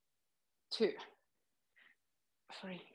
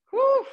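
breathy relieved whew; 12 semitones higher, 4 dB louder than usual. Real, not acted. Relief after tricep set.

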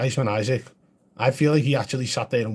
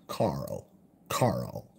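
much lower, softer, drawn-out call; mock American Rick Grimes; 10 semitones lower, 7 dB quieter than usual. Streamer mocking English actor's American accent, low and soft. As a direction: growl the name like a grieving TV sheriff. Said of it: "he says things like".

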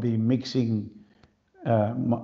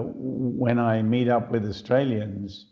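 ordinary talk, nothing marked

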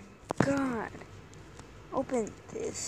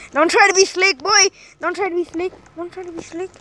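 loud teasing warning; 5 semitones higher, 7 dB louder, faster than usual. Real, not acted.

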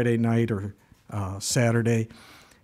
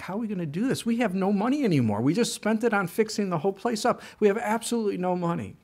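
high, fast, worried complaining; high frantic worry about money; retold panic; 7 semitones higher, faster than usual. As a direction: pitch up and rush like panicking.

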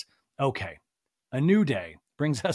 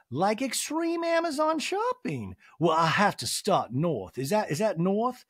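mock Sutton voice; 5 semitones higher than usual.

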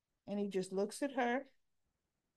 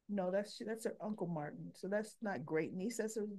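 normal talking voice

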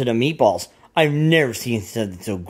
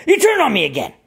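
higher, complaining voice; hurt Rosie; 11 semitones higher, faster than usual. Acted. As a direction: whine it like a betrayed little girl. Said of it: "And she's like".

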